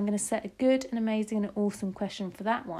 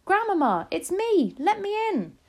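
high, urgent shouted plea; shouting; 13 semitones higher, 6 dB louder than usual. A narrator's reading. Child wanting grandmother to open up, shouting. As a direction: call through a shut door, loud. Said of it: "I shouted as loudly as I possibly could".

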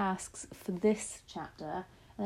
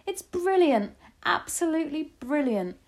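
very high, loud exclamation; delighted shout; 9 semitones higher, 6 dB louder than usual. A narrator's reading. Grandmother delighted by the cat plan, shouting. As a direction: shout your praise in delight. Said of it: "she shouted".

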